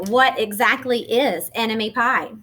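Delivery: usual speaking voice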